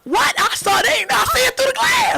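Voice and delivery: high, loud, excited storytelling; high loud outrage with a laugh; excited shout. Real, not acted. Excited retelling of the bet.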